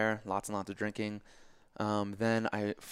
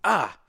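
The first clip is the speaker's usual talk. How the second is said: higher, louder cry of pain; mock pained "ah"; 9 semitones higher, 7 dB louder than usual. Swimmer retelling stepping barefoot on a rock, pained yelp. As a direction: imitate a sudden ouch.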